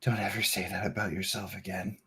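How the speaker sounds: soft, low, tender reassurance; hushed and gentle, comforting a crying friend; quiet caring voice. Acted. GM voicing Sprocket comforting.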